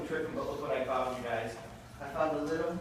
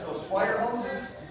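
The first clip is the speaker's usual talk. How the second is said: usual speaking voice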